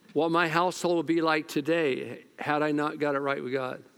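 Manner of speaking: ordinary talk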